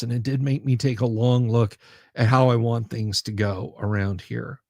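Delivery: normal talking voice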